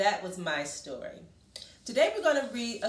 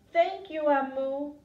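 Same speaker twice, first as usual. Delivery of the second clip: high and louder, happy thanks; little boy voice; 6 semitones higher, 5 dB louder than usual. Acted. Little boy thanks Amu for stew, high and loud. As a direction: thank her like a delighted small boy.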